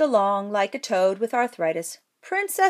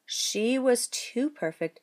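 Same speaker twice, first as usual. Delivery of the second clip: plain reading voice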